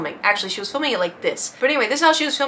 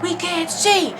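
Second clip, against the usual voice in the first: high, mock-outraged crowd yell; pitched-up retold complaint; throwing a fit; 8 semitones higher, slower than usual. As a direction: imitate angry people stuck at the back. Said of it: "were throwing a fit".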